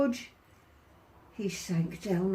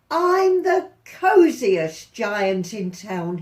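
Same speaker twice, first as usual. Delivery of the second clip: slow and louder, content; happy cry; 8 dB louder than usual. Acted. Giant in his gown, crying out happily. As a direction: proclaim it with happy pride. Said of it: "he cried".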